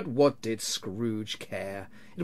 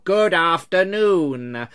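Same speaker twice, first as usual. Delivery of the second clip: loud, slow, drawn-out send-off; firm dismissal; 5 semitones higher, 9 dB louder, much slower than usual. A narrator's reading. Scrooge dismissing his persistent nephew, loud and firm. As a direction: cut him off loudly and slowly.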